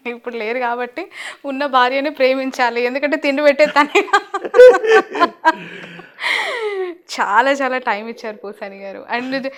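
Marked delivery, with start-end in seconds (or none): 4.1-7.2 గట్టిగా, పైస్థాయిలో హాయిగా నవ్వడం; మనస్ఫూర్తిగా గట్టిగా నవ్వండి; హాయిగా నవ్వు. Real, not acted. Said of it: "స్వచ్ఛమైన నవ్వు మీలో కనిపించింది". (పైస్థాయిలో స్వచ్ఛమైన నవ్వు)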